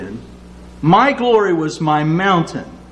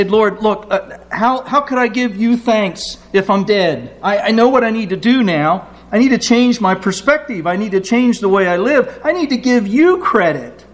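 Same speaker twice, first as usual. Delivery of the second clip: higher, earnest pleading to God; pleading psalmist; 7 semitones higher than usual. Acted. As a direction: beg the Lord to spare your life. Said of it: "and then I say".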